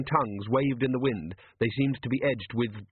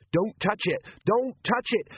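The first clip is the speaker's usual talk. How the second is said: high-pitched, urgent alarm; alarmed warning; 10 semitones higher than usual. Acted. Captain stopping troll touching flame, alarmed and high. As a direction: warn them off, voice rising sharply. Captain warns about dangerous fire.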